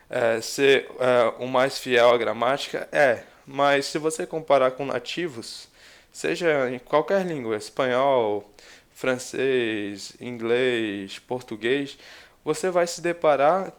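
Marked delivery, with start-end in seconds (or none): none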